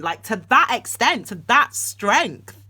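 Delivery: usual speaking voice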